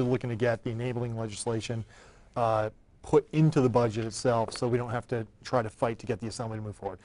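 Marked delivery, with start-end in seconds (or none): none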